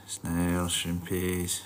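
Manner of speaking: usual speaking voice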